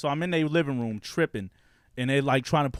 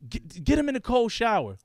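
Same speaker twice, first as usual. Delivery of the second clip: higher-pitched mock command; urgent relatives' order; 8 semitones higher than usual. Relatives trying to calm him down, higher mock order. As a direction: lightly mimic a relative giving orders. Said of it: "They's like".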